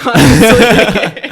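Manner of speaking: drawn-out, laughing admission; real laughing. Real, not acted. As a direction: burst out laughing on the word. Laughing at being lost for words.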